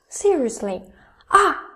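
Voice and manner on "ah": "Ah" high and loud, falling fast; a shocked "Ah" dropping from the top. Shown as a demonstration. Teacher compares fourth tone to shock, high falling "Ah". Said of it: "like you are shocked".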